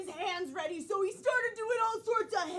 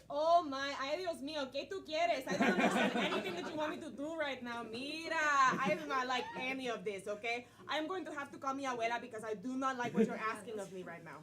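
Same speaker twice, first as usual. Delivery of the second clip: put-on Spanish accent; 8 semitones lower, 4 dB quieter than usual.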